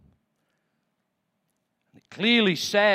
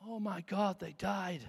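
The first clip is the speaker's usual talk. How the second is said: soft, much quieter lament; grieving hush; 12 dB quieter than usual. Real, not acted. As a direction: let the loss land quietly. Grief over the dead.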